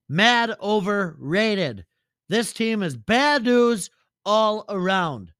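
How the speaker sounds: slow, loud, dismissive put-on voice; Lenny voice. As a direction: drag out the insult like a hater. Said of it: "Lenny, what do you got?".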